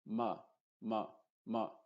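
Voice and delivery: MA as a short, relaxed 'muh'; MA with the vowel held back; modified U. Shown as a demonstration. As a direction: say MA with the mouth barely open. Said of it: "sino que es ma".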